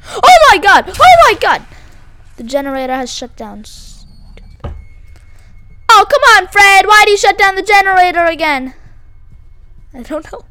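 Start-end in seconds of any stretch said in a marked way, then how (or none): 0.1-1.6 loud, high cries of alarm, the second higher; startled shouting. Real, not acted. Player scared in a horror game, startled shouting. 4.6-8.7 loud, raised-pitch complaint; exasperated shout. Real, not acted. Player scolding Fred for shutting the generator, exasperated shout.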